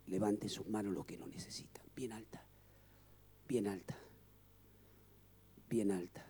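hushed whisper calling hands up. Real, not acted. Pastor whispering to the congregation to raise hands. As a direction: breathe the altar call softly. Altar call, hushed prayer voice.

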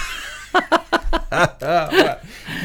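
high, drawn-out burst of laughter; hearty laugh. Real, not acted. Host amused by the Viale microclimate quip, real laughing. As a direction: laugh openly at the joke.

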